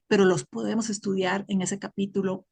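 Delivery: usual speaking voice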